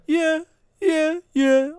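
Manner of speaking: high, spaced-out background ad-lib. Retold or imitated. Podcaster mocks producer's only part in the song, high ad-lib. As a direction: mimic a producer's high repeated vocal hook. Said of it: "with just [name] going".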